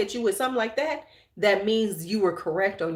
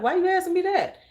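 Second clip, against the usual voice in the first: much higher, pointed challenge; suspicious why; 9 semitones higher, faster than usual. Retold or imitated. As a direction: call them out with a lifted voice. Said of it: "I'm like".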